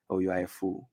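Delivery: gentle-voiced insult; said gently. Shown as a demonstration. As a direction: tell him softly to his face. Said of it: "I can say it gently".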